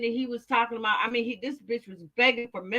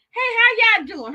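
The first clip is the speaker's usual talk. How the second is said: excited greeting; 9 semitones higher, 7 dB louder than usual. Real, not acted. Greeting viewers joining.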